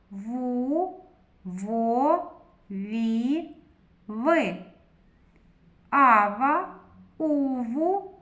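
put-on loud, clear syllables for kids to repeat; syllable drill for children. Shown as a demonstration. Teacher leading children to read syllables together, clear drill voice. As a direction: sound out each syllable like a class chant. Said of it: "Прочитаємо разом зі мною".

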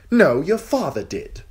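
put-on high, sneering young king; cruel boy king. King taunting the captive girl, high and sneering. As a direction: gloat down at her, spoiled and cruel.

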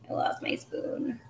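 breathy whisper, slowed, under the breath; real whispered aside. Real, not acted. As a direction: mutter it to yourself as if off-mic. Aside while hunting for spoon.

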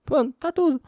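[name]. Retold or imitated. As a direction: gush like a chirpy festival reporter. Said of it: "das comentadoras do Rock in Rio".

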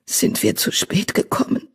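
husky whispered question; softly whispered worry; hoarse whisper. Acted. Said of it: "flüsterte er heiser".

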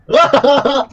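loud high laughter. Real, not acted. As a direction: burst out laughing. Laughs during game chaos.

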